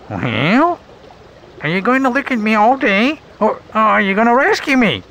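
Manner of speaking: high, sarcastic ladybug voice. Acted. Ladybug scolding her rescuers for staring, high and sarcastic. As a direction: needle them with a sarcastic jab. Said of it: "the insect said with sarcasm".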